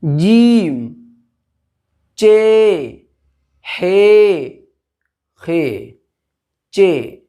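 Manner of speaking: loud drawn-out alphabet chant; slow loud classroom recitation; reciting letter names. Shown as a demonstration. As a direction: call out each letter for the class to repeat. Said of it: "उसके बाद है".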